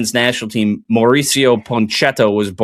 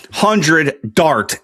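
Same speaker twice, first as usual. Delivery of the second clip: ordinary delivery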